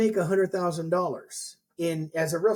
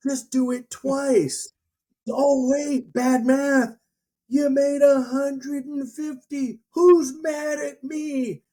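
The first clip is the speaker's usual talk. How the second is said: high, loud, slow stage-preacher pitch; hammed-up hype guru; 9 semitones higher, 4 dB louder than usual. Guru hyping a seminar crowd about money, mock preacher voice. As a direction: whip the room up like a revival preacher.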